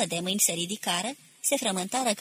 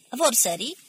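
higher-pitched shouted question, mild; called out; 4 semitones higher, 4 dB louder than usual. A narrator's reading. He challenges the men about the wounded, calling out. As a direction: call it out lightly. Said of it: "strigă el".

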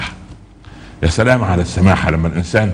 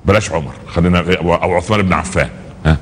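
usual speaking voice

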